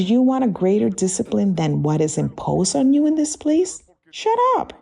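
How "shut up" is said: sharp command. Real, not acted. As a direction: shout it suddenly, much higher. Pastor commands the congregation.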